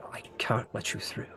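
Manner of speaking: near-whispered, reluctant knight; hushed refusal. Acted. Knight blocking Terrence's way, hushed refusal. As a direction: say it very softly, almost voiceless.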